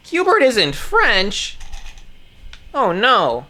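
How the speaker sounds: high, slow, mock-horrified question; mock shocked gasp. Reading aloud to her audience, mock-horrified at the news. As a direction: feign shock that Hubert isn't French.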